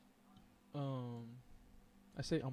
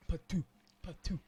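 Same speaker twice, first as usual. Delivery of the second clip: high mock spitting "ptoo"; Ugandan Knuckles; 4 semitones higher, 4 dB louder than usual. Streamer mimicking the meme's spitting, high cartoon "ptoo". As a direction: spit it out twice, pitched up. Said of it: "Ugandan Knuckles was my favorite".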